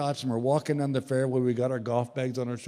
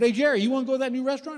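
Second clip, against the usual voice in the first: high, fast eager invitation; eager invite; 10 semitones higher, much faster than usual. Retold or imitated. Calling buddy Jerry to invite him out, fast and eager. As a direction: rattle it off, keen to go.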